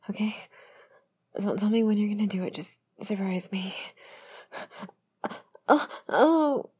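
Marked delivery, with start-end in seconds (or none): 5.7-6.7 loud, high "oh, oh"; sudden rising gasped cries; startled moan. Pretend. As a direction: cry out in surprised pleasure. Reacts to being surprised.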